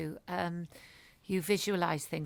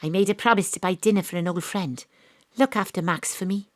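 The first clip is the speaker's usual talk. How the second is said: louder, first-person detective narration; in-character drama narration; 10 dB louder than usual. Acted. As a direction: play Astrid walking away from the case. Astrid drama scene, acted narration.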